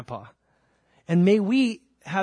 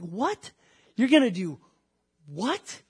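astonished, rising questions with pauses; acted overwhelmed disbelief; 4 semitones higher, slower than usual. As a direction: act amazed at an impossible promise. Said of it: "it just overwhelms you and cracks you up".

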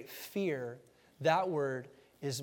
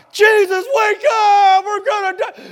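high, screaming panic, near crying; panicked scream; 17 dB louder than usual. Retold or imitated. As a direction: scream for your life. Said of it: "They were freaking out".

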